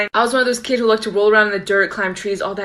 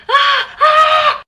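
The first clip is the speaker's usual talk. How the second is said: short high screams, much louder; mock scream; 6 dB louder than usual. Retelling the tarantula moment to viewers, high yelps of fright. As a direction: shriek like a spider just flew past. Both freaking out at spider.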